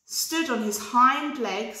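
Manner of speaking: ordinary delivery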